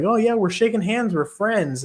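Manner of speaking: high, falsely friendly voice; mock friendly taunt. Fighter fooling his opponent into friendship, high and sugary. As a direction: pretend to be buddies, sweetly. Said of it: "He's just like".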